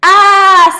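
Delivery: put-on loud, high girl's scream of terror. A narrator's reading. Cindy screaming as the wolf comes to eat her. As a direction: shriek as the wolf lunges. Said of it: "screamed Cindy".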